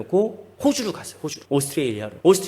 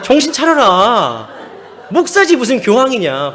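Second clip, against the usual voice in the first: loud, raised, drawn-out reproach; mock scolding; 6 semitones higher, 6 dB louder, slower than usual. Preacher corrects friend who said pope, mock scolding. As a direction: set him straight with comic exasperation.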